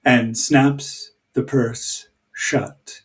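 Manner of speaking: normal talking voice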